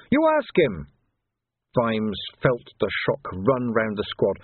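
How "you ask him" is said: higher, louder panicked accusation; Quirk accusing. Acted. Quirk blurting out blame at young Sam Vimes. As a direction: point the finger, voice jumping up.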